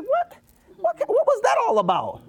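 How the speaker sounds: very high, incredulous squeal; offended disbelief. Acted. Congregant upset at a snubbed child's treatment, offended disbelief. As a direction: react high-pitched, taken aback at the snub. Acted upset reaction to a snub.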